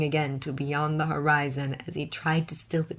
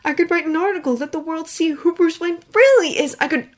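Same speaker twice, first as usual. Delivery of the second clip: higher, louder eager pitch; eager Clark; 12 semitones higher, 7 dB louder, faster than usual. A narrator's reading. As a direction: sell the idea.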